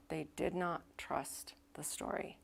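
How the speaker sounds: hushed, breathy whisper. Real, not acted. Pastor telling the congregation the key point, in a whisper. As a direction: lean in and whisper it.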